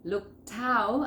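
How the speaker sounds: "Look out" split as loo-kout; linked words. Shown as a demonstration. Teacher pointing out where it joins, exaggerated linking. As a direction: carry the k of "Look" onto "out". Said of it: "acá se une".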